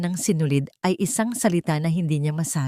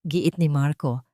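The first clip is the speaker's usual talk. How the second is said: usual speaking voice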